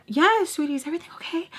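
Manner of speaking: high, sugary customer-service voice; a mock sugary "sweetie" voice; sweet librarian. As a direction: play the overly sweet librarian. Retold librarian's sugary reply.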